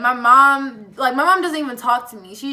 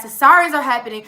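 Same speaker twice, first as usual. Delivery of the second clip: ordinary talk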